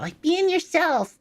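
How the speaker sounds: squeaky cartoon voice, way up high; silly high voice. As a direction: pitch it up into a goofy squeak. Silly high voice, far timbre.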